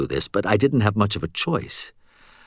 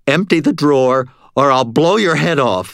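louder, higher shouted demand; shouted threat; 6 semitones higher, 5 dB louder than usual. A narrator's reading. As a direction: yell it to scare the cashier. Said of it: "I shouted".

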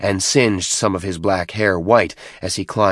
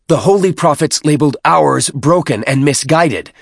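much higher and louder, declaiming; rousing the pack; 7 semitones higher, 6 dB louder than usual. Acted. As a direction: stir up the warriors against him. Tartarus rouses the pack.

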